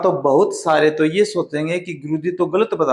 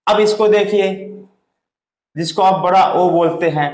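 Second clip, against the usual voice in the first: ordinary talk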